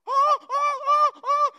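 high, loud panicked "oh, oh"; hammed-up shrieks of fright; mock panic. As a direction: squeal in mock fright at a bug. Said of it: "just freaking out".

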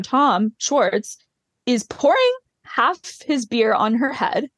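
usual speaking voice

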